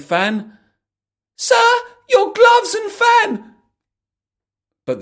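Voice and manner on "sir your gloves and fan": high, loud, slow call; Alice shouting to the fleeing rabbit. Acted. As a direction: shout after the running rabbit. Alice calling after rabbit.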